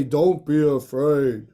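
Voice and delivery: low and slow, a god's calm reassurance; deep voice. Shown as a demonstration. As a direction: drop the voice low and draw it out. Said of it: "Can we all have a deep voice".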